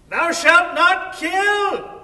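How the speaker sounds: high, loud, slow yell on Thou shalt not kill; student yelling the verse to the neighbors; yelled out the window. Retold or imitated. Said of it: "she yells out the window".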